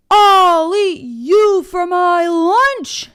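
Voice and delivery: slow, loud, high troll threat. A narrator's reading. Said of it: "said the troll".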